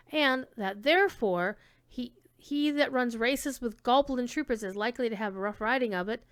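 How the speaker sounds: ordinary delivery, nothing marked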